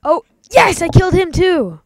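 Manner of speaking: high, loud triumphant cheer; excited cheer. Real, not acted. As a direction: celebrate the lucky shot. Lucky hit in game.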